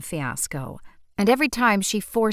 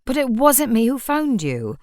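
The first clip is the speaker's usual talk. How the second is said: a mild plea, a little higher and louder; pleading; 5 semitones higher, 6 dB louder than usual. Acted. Shopkeeper pleading with the irate customer, mildly. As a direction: gently plead your innocence. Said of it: "Almost pleadingly".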